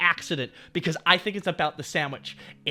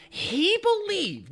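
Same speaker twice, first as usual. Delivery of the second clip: very high, drawn-out words; incredulous emphasis; 13 semitones higher, much slower than usual. Retold or imitated. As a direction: stretch it out up high for dramatic disbelief.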